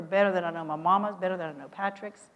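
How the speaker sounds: ordinary talk, nothing marked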